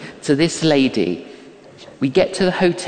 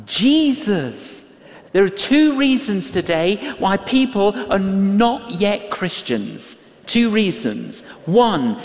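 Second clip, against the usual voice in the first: usual speaking voice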